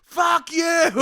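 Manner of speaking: very high, loud holler; mock a shout from the back; heckler's yell. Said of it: "the guy in the back's like".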